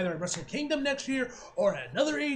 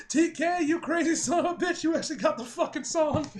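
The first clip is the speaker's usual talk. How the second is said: mildly hyped, higher-pitched reaction; excited disbelief; 6 semitones higher than usual. Retold or imitated. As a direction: exclaim it like a thrilled fan. Acts out his thrilled reaction.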